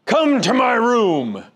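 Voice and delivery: slow, loud, high gruff command; gruff old preacher. Retold or imitated. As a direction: order it slowly, no room to refuse. Gruff preacher voiced in story.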